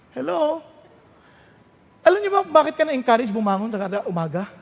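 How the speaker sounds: ordinary talk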